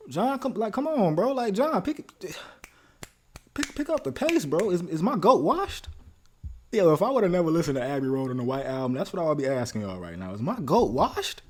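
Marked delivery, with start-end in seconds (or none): 0.1-5.9 high, loud calling out for a missing hero; mock exasperated plea. Fan calling out his favorite Beatle for slacking, exasperated. Mock-calls on absent [name] to improve. 10.3-11.4 high, loud, drawn-out disbelief; incredulous question. Acted. Fan asking whether his hero has lost it, incredulous. Mock-doubts his favorite Beatle.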